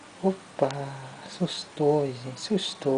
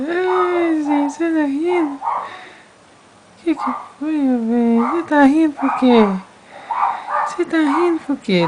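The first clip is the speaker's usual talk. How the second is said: delighted squeal; 14 semitones higher, 11 dB louder than usual. Real, not acted. Parent delighted baby is laughing.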